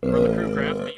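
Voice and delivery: low booming announcer; deep announcer voice. Pretend. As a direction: boom it like a trailer. Announcer intro.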